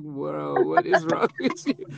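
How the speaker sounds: incredulous. Retold or imitated. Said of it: "I was like".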